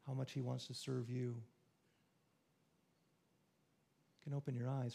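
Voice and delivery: guided meditation. Acted. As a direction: lead them gently through the image. Leads a guided imagining.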